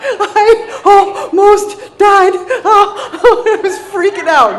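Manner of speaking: high, loud, slow laughing cry; laughing shout. Real, not acted. Laughing retelling of panic.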